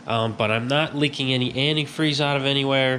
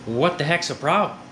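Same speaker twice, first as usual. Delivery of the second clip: ordinary talk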